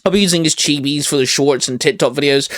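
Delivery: ordinary talk, nothing marked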